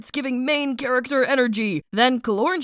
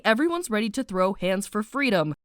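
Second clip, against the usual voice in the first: normal talking voice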